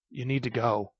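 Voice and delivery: usual speaking voice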